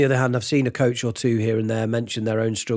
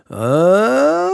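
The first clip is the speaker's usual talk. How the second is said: tight, pushed-up vocal slide climbing higher; strained rising cry; 8 semitones higher, 6 dB louder than usual. Shown as a demonstration. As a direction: let the throat grab as the pitch rises. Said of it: "this starts to really".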